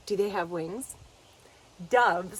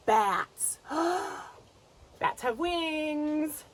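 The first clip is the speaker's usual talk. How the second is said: ordinary delivery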